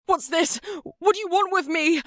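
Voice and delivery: high, frightened voice; desperate cries. Acted. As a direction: panic in the dark. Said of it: "Duin's desperate cries".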